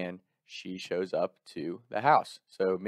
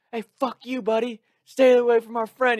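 high, loud taunting yell; mock yell; 14 semitones higher, 7 dB louder than usual. Taunting the drunk kid thrown out, high loud yell. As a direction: shout him down, cocky and high. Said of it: "we're screaming back and forth".

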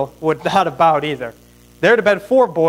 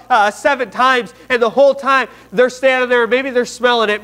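raised pitch, driving sermon storytelling; impassioned preaching; 7 semitones higher, 3 dB louder than usual. Real, not acted. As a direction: drive the Bible story forward with force. Preaches at heightened force.

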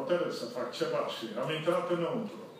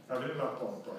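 ordinary talk

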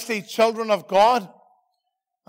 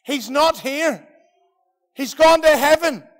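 slow, deliberate, rising to a loud He's gone; prophet Elisha; 4 semitones higher, 4 dB louder, slower than usual. Acted. Elisha telling the searchers, slow, then a loud proclamation. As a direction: lay it out slowly, then proclaim it. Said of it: "And he says".

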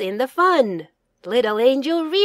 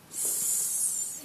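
whispered sound; 17 dB quieter than usual. Shown as a demonstration. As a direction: whisper just the S hiss. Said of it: "Sound it out".

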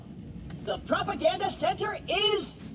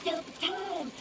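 a robot hamming up sneaking past cultists; mock stealth hush; 8 dB quieter than usual. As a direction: hush it like a cartoon spy. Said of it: "Stay quiet and follow my every move".

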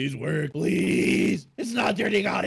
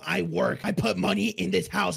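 ordinary delivery

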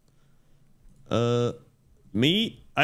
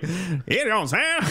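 high twangy sung line; twang; 8 semitones higher, faster than usual. Pretend. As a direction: pinch it into a twang. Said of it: "you do the twangy voice".

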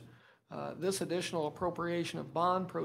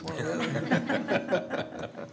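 slow, laughing through words; 4 dB louder, much slower than usual. Real, not acted. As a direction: chuckle while saying it. Laughs at own joke.

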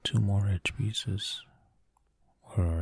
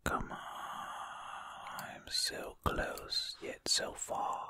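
soft breathy whisper to the mic; hushed running commentary; ASMR whisper; 5 dB quieter than usual. Acted. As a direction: whisper close and intimate while solving. Whispered ASMR puzzle play.

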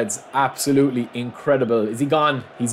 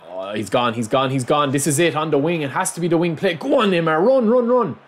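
climbing from usual voice to high yelling; building excitement; 5 semitones higher than usual. Real, not acted. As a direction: urge the runner on, ever higher. Winger breaks away, commentary builds.

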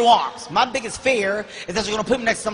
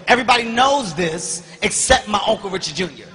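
ordinary talk

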